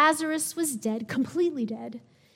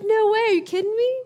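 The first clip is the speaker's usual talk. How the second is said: much higher and louder, gasping in shock; stunned disbelief; 9 semitones higher, 8 dB louder than usual. Retold or imitated. Onlookers watching the dead man walk out, high astonished voices. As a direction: play a bystander who can't believe his eyes.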